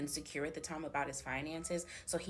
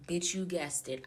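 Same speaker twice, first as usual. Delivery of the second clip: normal talking voice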